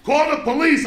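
high-pitched imitated call; retold yell. Kids watching the nun dragged out, retold yell. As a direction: call it out as the alarmed onlookers. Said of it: "They're yelling".

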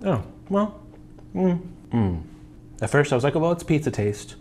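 muffled through food; chewing while talking. Real, not acted. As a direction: mouth partly full. Seen 1: Bites at -2; closed, working jaw while speaking, clearer by 5.9.

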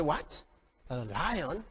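low, very soft incredulous questions; hushed disbelief. Acted. King Saul hearing of the lion-killer, hushed disbelief. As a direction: drop right down, quietly taken aback. Saul doubts the report of David.

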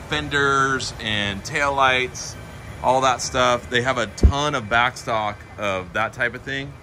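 usual speaking voice

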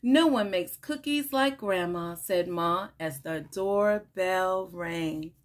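animated picture-book read-aloud with lively character lines; reading to children. Acted. Teacher reading a picture book to children, bright and animated. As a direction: read it like story time for little kids.

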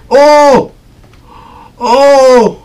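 sudden high, loud frightened cry; real jolt of fright at a monster; startled scream. Real, not acted. Player jumps at the monster, loud scared yelp.

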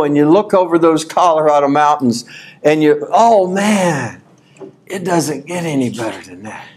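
ordinary talk, nothing marked

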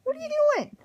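high, loud, startled telling-off; exasperated scold. Real, not acted. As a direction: call out at the misbehaving pet. Scolding pet biting couch.

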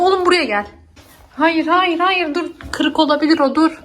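firm warning. Real, not acted. Stopping the baby grabbing the stick.

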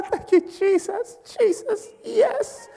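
high, gasping mock rapture; overjoyed worshipper. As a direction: imitate someone overcome by a prophecy. Voices a thrilled prophecy recipient.

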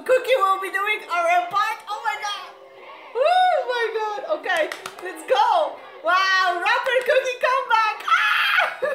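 high, loud fan squeals; excited screaming. Real, not acted. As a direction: shriek with joy as the rap starts. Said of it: "that I was screaming so much".

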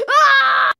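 high straining yell; strained yell. Acted. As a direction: cry out with effort. Straining in the contest.

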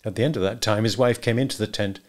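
ordinary delivery, nothing marked

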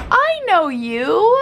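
high, gushing fan excitement; mock starstruck. Vlogger greets own reflection as annoying vlogger, mock excited. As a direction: squeal a pretend greeting to a celebrity. Pretends to recognise herself, cheering.

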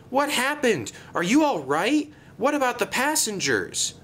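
high, alarmed questions; gasped. A narrator's reading. Thomas questioning the damaged bus, high and alarmed. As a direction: fire off worried questions, pitched high. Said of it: "he gasped".

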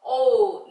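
오우 sung out loud as a long gliding o-u; 오우 held loud and gliding; long o demo. Shown as a demonstration. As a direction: belt out 오우 for the class. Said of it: "오의 소리는 오우 이렇게요".

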